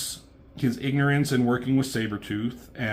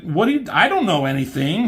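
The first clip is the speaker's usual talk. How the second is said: high, loud frightened denial; pitched-up scared reply; panicked protest; 8 semitones higher, 7 dB louder than usual. Retold or imitated. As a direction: blurt it out in a panic. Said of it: "It's like".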